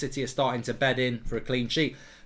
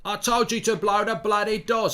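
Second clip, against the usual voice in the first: loud, higher-pitched Cockney film-star impression; Michael Caine; 5 semitones higher, 4 dB louder than usual. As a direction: exclaim it like a Cockney actor. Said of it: "I can't do Michael Caine".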